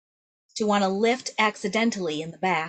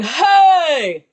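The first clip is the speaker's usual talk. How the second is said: loud, higher call in the wrong placement; wrongly placed yell; 6 semitones higher, 11 dB louder than usual. Shown as a demonstration. Teacher shows what students should avoid, loud misplaced call. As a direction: demonstrate a faulty loud belt. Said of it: "we don't want that".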